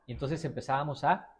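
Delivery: ordinary delivery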